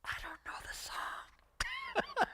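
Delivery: slow, unvoiced whisper back. Newcomer answering the nudging churchgoer, slow whisper. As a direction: whisper an embarrassed excuse, slowly. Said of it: "I'm like".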